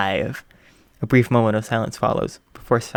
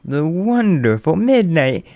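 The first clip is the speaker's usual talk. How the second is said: announcer; 4 semitones higher, 4 dB louder, slower than usual. Acted. Host introducing guest on air.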